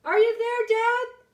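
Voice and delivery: high loud call, the last words drawn out slowly; a son shouting for his missing father. Acted. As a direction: hurl the question into the dark. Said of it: "I shouted".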